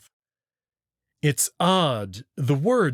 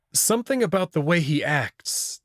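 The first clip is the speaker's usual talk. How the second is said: plain reading voice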